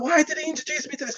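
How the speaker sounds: indignant viewer. Retold or imitated. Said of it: "he's like".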